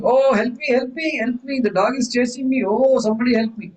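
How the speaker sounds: high, pleading cry for help; high-pitched panicked plea; mock panic. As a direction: act out a dreamer in a nightmare. Dreamer screaming for help.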